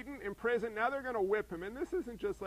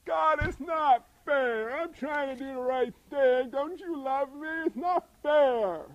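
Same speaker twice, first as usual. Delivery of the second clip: slow, loud, higher complaint to God; whining prayer; 6 semitones higher, 7 dB louder, slower than usual. Retold or imitated. Pastor imagining Paul complaining to God, slow loud whine. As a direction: plead like a wronged child. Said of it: "I think I might go".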